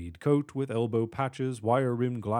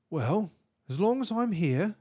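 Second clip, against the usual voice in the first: high, musing British professor; high-pitched British aside to oneself; 8 semitones higher than usual. A narrator's reading. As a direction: think aloud in a British accent. Professor musing to himself alone.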